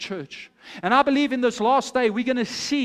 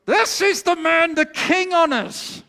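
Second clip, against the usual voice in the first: high, loud, slow, forced through the teeth; through gritted teeth; 6 semitones higher, 5 dB louder, slower than usual. Acted. Humiliated man honouring his rival, through gritted teeth. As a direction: say it with teeth clenched in fury. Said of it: "biting through his teeth".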